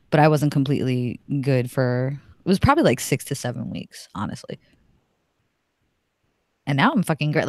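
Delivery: normal talking voice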